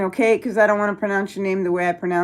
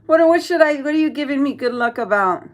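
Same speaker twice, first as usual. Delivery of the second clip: normal talking voice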